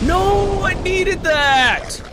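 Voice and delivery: very high, loud drawn-out protest; anguished yell. Real, not acted. Gamer losing a needed item, anguished high yell. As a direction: wail it slowly at the top of the voice.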